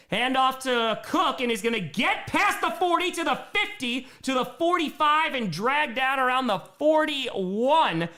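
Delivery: high, building call into a shouted first down; hyped announcer calling a big gain; excited play-by-play. Acted.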